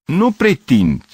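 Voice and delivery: slow, loud, high objection; indignant protest. A narrator's reading. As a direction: protest forcefully, drawing out the words. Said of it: "protestă Gavrilescu".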